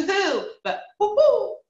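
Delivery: high, slow hoo-hoo then woo-hoo; owl hoots contrasted. Shown as a demonstration. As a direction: show the wrong hoot then the right one. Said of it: "you don't wanna go".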